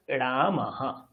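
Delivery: "রামাহা" said slowly, visarga echoed as "হা"; slow pronunciation demo. Shown as a demonstration. Teacher demonstrating visarga for students, slow and careful. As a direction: stretch out "রামাহা" so the ending "আহা" is clear. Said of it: "ধরুন, আমি একটা শব্দ লিখছি".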